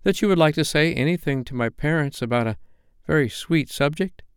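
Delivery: mildly hesitant, feeling out the answer; falteringly. A narrator's reading. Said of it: "she said falteringly".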